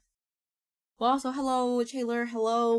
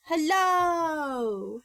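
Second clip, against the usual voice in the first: bright high call, louder; excited greeting; 7 semitones higher, 7 dB louder than usual. Real, not acted. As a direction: sing out a big hello. Greeting arriving viewers.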